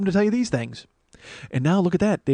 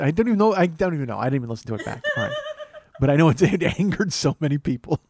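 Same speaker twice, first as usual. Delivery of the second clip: ordinary talk, nothing marked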